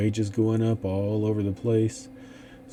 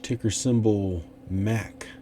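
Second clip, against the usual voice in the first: ordinary talk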